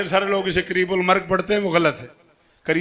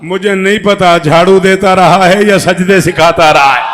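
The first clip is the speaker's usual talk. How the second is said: loud punchline; 9 dB louder than usual. Real, not acted. Preacher's punchline to crowd.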